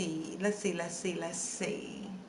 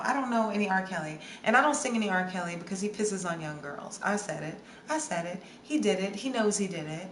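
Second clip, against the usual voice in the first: usual speaking voice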